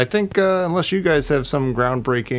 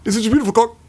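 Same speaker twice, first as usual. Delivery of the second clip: high, chopped-off movie line; awkward clipped line; 8 semitones higher, much faster than usual. Retold or imitated. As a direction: cut it off abruptly, awkwardly. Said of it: "so awkward and clipped".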